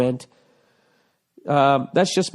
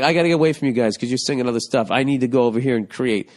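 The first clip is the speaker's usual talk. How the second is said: fast mocking quote; faster than usual. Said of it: "he's like".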